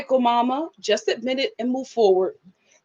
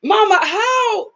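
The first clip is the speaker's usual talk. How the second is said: incredulous; 8 semitones higher, slower than usual. Retold or imitated. Incredulous retort to mom.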